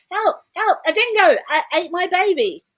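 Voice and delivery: imitated cry for help, high and loud; screaming for help. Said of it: "screaming for help".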